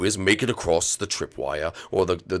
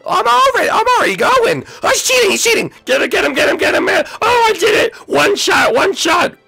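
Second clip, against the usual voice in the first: squeaky, hyper monkey voice; Diddy Kong voice; 5 dB louder than usual. Acted. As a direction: chatter like an excited little monkey. Diddy Kong runs and cheers.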